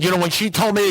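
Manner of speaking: high-pitched imitation of protesting; frantic protest. Protesting as sponsor keeps cutting in.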